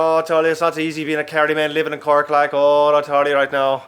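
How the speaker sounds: loud, mock Irish comedian accent; local Irish comic. Mimicking an Irish comedian's local references, mock Irish accent. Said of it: "You just get this comedian".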